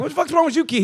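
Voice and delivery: high, loud mock snap; mock snarl. Jaded kid yelling at a younger kid, loud. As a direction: bark it like a tough little punk.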